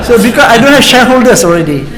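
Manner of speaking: chuckling while admitting no shareholders; laughing. Real, not acted. Retired panelist to the audience, laughing it off. Joking about being retired.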